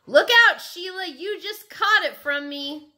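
loud, high warning cry; excited shout. A narrator's reading. Peter tags Sheila while twirling, excited shout. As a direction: call out as you pass on a tag.

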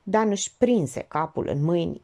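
plain reading voice

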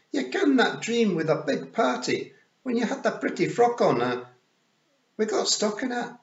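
high, loud Scots pictsie, defensive; Rob Anybody. Acted. Rob excusing himself to Tiffany, high Scots pictsie voice.

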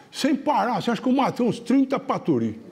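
high-pitched bragging of a tall-tale teller; boastful excitement. Retold or imitated. Hunter boasting to his buddy of his kill, excited brag. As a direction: crow about it, pitched right up.

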